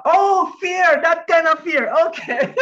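loud, high-pitched dawning of understanding; real burst of amused surprise; sudden realization. Real, not acted. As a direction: exclaim as it finally clicks, breaking into a laugh. Suddenly understands the student's answer.

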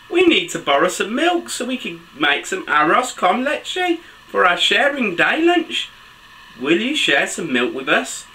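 put-on animated children's story reading, high excited exclamations; picture-book narration. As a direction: read to little ones, pitch leaping between narration and exclamations. Reads picture book aloud to children.

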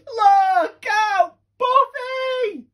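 high, loud urgent yell; warning shout. Acted. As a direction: shout to save your friend. Warning friend of crate.

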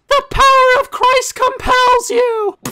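high, loud, slow exorcism cry; mock exorcist. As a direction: bellow it like a hammy priest.

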